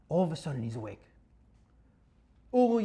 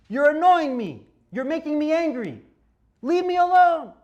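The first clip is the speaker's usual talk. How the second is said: louder, higher, fed-up snapping; annoyed outburst; 7 semitones higher, 8 dB louder than usual. Acted. Rabbi acts out someone bothered, annoyed and louder. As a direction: push them away, rising to leave me alone.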